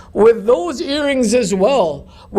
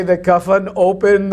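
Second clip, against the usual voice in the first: usual speaking voice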